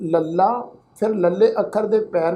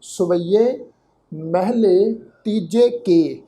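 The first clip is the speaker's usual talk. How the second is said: slow, drawn-out heading; measured Gurbani reading; scripture recitation; much slower than usual. Shown as a demonstration. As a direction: recite it word by word, unhurried. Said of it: "ਪਾਠ ਉਚਾਰਨ ਕਰਨਾ".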